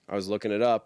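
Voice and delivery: ordinary delivery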